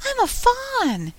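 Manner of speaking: very high, drawn-out joyful fawn voice; cry of delight. A narrator's reading. Fawn suddenly remembering what it is, crying out in delight. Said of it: "cried out in a voice of delight".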